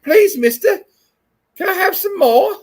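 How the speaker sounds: high, loud British orphan voice; high-pitched English child begging; mock English kid. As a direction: plead like a little English boy. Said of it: "This kid's like".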